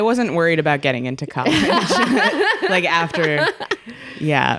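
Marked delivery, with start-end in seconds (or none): 1.5-3.5 higher pitch, laughing through words. Real, not acted. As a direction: laugh while saying it. Laughing at college joke.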